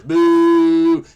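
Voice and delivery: loud booing call; mock boo. Acting out a tough crowd's boo, loud.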